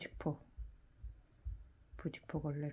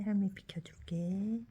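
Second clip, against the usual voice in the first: talking to a dog; 6 semitones higher, 6 dB louder, slower than usual. Real, not acted. Grandma cooing to the dog.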